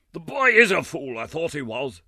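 high, loud, scornful gentleman; sneering gentleman. A narrator's reading. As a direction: sneer at the boy as a fool.

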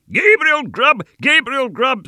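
very high, loud shriek; wild screaming chorus. A narrator's reading. Invisible voices taunt Gabriel, high wild screaming. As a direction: scream it like a wild goblin mob. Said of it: "screamed a wild chorus of voices".

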